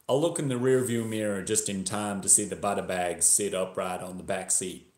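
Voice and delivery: put-on story read in a Texan accent; attempted Texas accent. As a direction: narrate as a Texan, imitated accent. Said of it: "I'm going to attempt a Texas accent".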